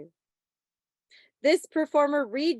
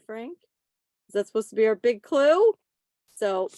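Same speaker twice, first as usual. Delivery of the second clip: ordinary talk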